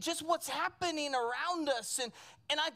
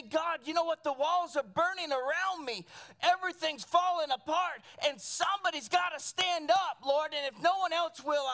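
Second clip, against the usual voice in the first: raised, impassioned plea acted from the pulpit; crying out to God; 3 semitones higher, 4 dB louder than usual. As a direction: preach it as an anguished prayer. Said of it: "he began to cry out to God".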